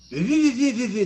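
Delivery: high, loud bougie French babble; mock bougie French. Actor mocking rivals at the audition, bougie fake French. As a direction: gabble fake posh French sounds. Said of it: "bougie bullshit French".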